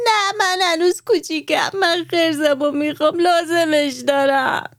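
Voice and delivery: put-on high tearful little-girl plea, slowing at the end; crying little girl. Maryam crying to her parents for her bear, high tearful plea. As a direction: beg through tears, high and childlike. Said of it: "زار زار گریه کرد".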